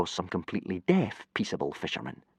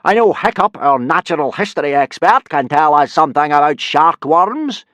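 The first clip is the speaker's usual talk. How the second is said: loud, higher blustering Viking; booming teacher; 8 semitones higher, 11 dB louder than usual. Acted. Viking teacher instructing boys in a booming voice. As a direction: lecture the lads at full volume. Gobber's voice held.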